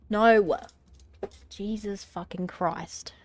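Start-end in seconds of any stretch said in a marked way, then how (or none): 0.1-0.6 loud sudden no at the cat; sharp no. Real, not acted. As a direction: shout to stop the jump. Cat about to jump.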